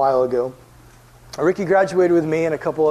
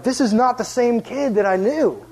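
astonished; 7 semitones higher than usual. Retold or imitated. Visitor seeing the kid playing and laughing, astonished. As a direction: stretch it out in acted amazement. Acts out amazement at changed kid.

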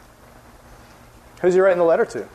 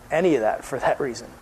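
normal talking voice

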